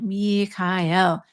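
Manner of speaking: Michael intoned slowly as mee-kai-el; emphasized vowel sounds. Shown as a demonstration. Host models saying the angel's name out loud, vowels emphasized. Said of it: "or say it out loud".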